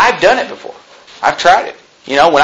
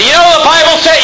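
mock preaching; 10 semitones higher, 3 dB louder than usual. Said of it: "All of a sudden I just say".

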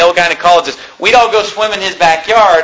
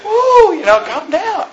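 laughing calm down; 13 semitones higher, slower than usual. Retold or imitated. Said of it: "I said".

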